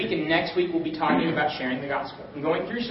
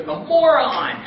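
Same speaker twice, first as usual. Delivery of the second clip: punchline shout; 6 semitones higher, 6 dB louder, much slower than usual. Retold or imitated. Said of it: "He finally goes".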